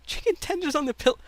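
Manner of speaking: very high-pitched incredulous echo; amused disbelief. Real, not acted. Host still thrown by guest's joke, high incredulous echo. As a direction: repeat it high, unable to get past it.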